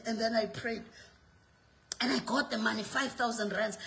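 low, soft confiding story; hushed storytelling. Real, not acted.

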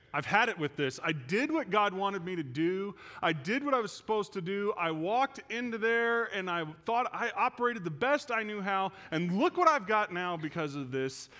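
indignant complaint at full voice; fed-up outburst. Retold or imitated. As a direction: vent to a friend, hitting the key words hard. Retells his fed-up outburst.

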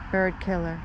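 ordinary talk

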